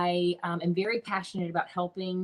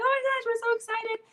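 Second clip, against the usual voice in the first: high, loud gushing voice; excited husband; 4 dB louder than usual. Retold or imitated. Husband reacting to twins on screen, high excited gush. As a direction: gush like a thrilled new dad. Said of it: "my husband's like".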